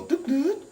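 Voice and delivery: two high little machine toots; high electronic double beep; imitated switch-off noise. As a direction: mimic a gadget powering down, pitched high. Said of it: "turn off or shut off or go".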